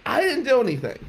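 high-pitched plea of innocence; protesting innocence. Retold or imitated. Nephew to disbelieving aunt and mom, protesting innocence.

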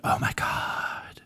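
breathless unvoiced whisper. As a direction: gasp it in a whisper. Imagined listeners gasping.

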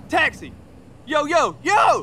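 high calls building to a shout; hailing a taxi. Acted. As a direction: yell for a cab down the street. Brody hails a cab.

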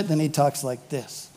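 low, soft undertone; behind-their-backs voice. Acted. How he talks when those people aren't there, hushed and low. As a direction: drop it low, as if they've gone. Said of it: "when those people aren't there".

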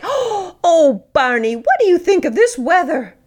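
put-on high, delighted baby talk; talking to a dog. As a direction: coo cheerfully at the dog. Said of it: "then I get to say".

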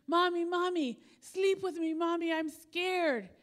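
put-on high, pleading little boy voice, slowing on scared. Little boy begging his mom during a thunderstorm, high and pleading. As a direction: beg like a frightened five-year-old.

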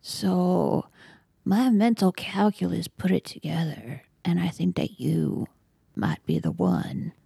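hushed, half-whispered in-character threat; whispery mock-villain speech with deliberate pauses; mock menace. Player continues menacing speech to bully.

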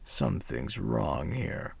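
a quiet suspicious growl of a devil. A narrator's reading. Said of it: "he said".

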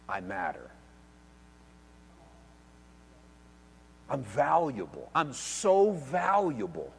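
soft, slow and hushed; slow soft affirmation; quiet. Acted. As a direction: say it softly, as a private truth. Quiet inner meaning of name.

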